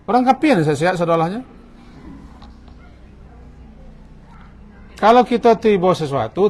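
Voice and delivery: lauter, höherer Predigtton mit voller Kraft; fiery preaching. Acted. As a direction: predige mit ganzer Wucht zur Gemeinde. Preaches at full force.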